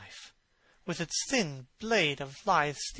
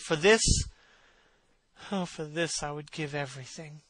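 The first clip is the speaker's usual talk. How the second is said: ordinary delivery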